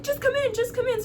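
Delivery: high-pitched quoted call to enter; urgent beckoning. Retold or imitated. Grandparents calling the visitor inside, high beckoning.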